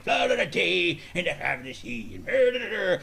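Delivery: drawn-out mock pirate gibberish; pirate talk. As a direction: mumble rhymes like a stage pirate. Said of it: "I talked like a pirate".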